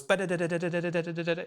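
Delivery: quick run of ba-da-da syllables, rattled off fast; rapid scatted notes. Retold or imitated. Showing viewers how his picking hand just runs. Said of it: "my hand goes".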